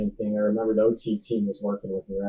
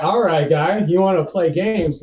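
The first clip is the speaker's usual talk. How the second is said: louder and higher, a fired-up dare; pissed-off challenge; 6 semitones higher, 7 dB louder than usual. Retold or imitated. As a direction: throw the challenge back, riled up. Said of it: "pissed me off".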